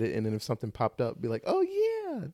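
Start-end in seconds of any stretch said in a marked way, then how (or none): 1.5-2.3 high-pitched, drawn-out "oh yeah" of recognition; mock sudden realization. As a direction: act out suddenly remembering, voice up high. Acts out own sudden recollection.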